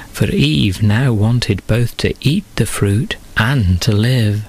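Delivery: ordinary delivery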